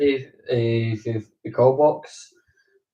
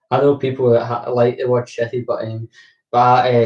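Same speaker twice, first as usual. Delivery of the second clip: usual speaking voice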